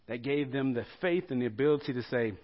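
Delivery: ordinary talk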